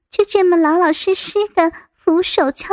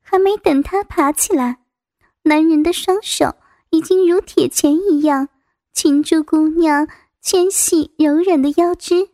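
usual speaking voice

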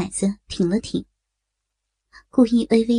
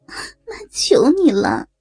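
tearful pleading; 2 semitones higher than usual. A narrator's reading. Mother-in-law begs son-in-law to stop asking, crying. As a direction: beg through sobs, rising toward the end. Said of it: "沈春荣又哭了起来".

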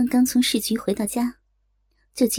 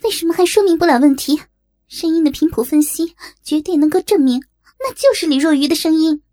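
loud, higher-pitched indignant rebuttal; heated protest; 6 semitones higher, 6 dB louder than usual. A narrator's reading. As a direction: push back forcefully against the doubt. Heated rebuttal to hesitant mayor. (激动地高声反驳)

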